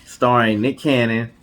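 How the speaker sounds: slow, dramatic reveal read like a trailer; announcer reveal. Acted. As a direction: announce the movie credits with a show host's flourish. Dramatic reveal of movie credits.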